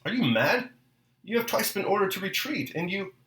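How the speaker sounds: high-pitched, restrained narrator's shout; gasping shout. A narrator's reading. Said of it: "He was shouting in a gasping voice".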